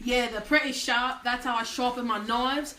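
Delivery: broad, put-on Australian accent. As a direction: play a cheerful Aussie mate. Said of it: "I'll do my Australian accent".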